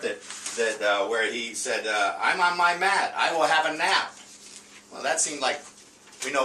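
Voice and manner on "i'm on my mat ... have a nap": lightly voiced dog, a little higher and laughing; recalling the dog's line for the class, lightly voiced. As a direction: quote the dog with a chuckle. Teacher re-reads dog's lines.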